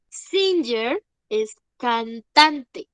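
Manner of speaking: very slow, leaning on "Singer"; teacher explaining "Singer" slowly; slow teaching. Shown as a demonstration. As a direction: spell it out slowly for the class. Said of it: "Son diferentes".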